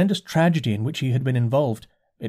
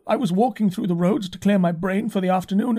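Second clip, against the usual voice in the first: complaining curate; 8 semitones higher than usual. A narrator's reading. Curate recalling his walk to the narrator, high and rising. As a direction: tell it high, rising, bewildered.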